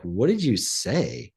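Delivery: slow, drawn-out disbelieving question; retold stunned challenge; incredulous confrontation. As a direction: stretch it out, demanding an answer. Retold husband confronting his wife.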